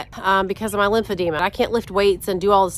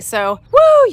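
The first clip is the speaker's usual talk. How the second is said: high woo; 13 semitones higher, 5 dB louder than usual. Real, not acted. Done tilling five rows.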